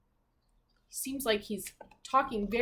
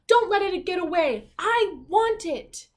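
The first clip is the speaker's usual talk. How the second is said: higher and louder, demanding; spoiled princess crying out; 7 semitones higher, 8 dB louder than usual. Acted. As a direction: want it now, like a spoiled princess. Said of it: "she cried".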